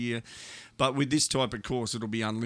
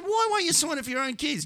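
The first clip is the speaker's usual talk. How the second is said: exasperated question. Retold or imitated. Agent pleads in disbelief.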